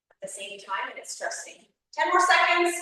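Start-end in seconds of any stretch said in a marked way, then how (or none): 1.9-2.8 loud high coach callout; barking gym coach. Real, not acted. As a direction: bark the time left at the class. Coach calling out during exercise.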